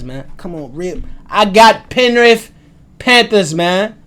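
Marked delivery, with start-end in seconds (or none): 1.3-4.0 slow, very loud, high declaration; emphatic full-volume fan call; shouted team pick. Real, not acted. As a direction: shout your pick out, drawing it out.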